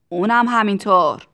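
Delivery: loud reply over the din; shouted answer. A narrator's reading. Factory owner answering about Tistu's father, loud shout. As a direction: answer at full volume.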